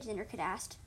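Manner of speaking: plain reading voice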